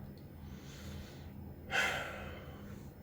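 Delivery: soft, drawn-out sigh; heavy sigh. Real, not acted. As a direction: breathe out a real, quiet sigh. Pausing over looming deadline.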